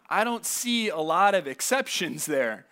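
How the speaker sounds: ordinary delivery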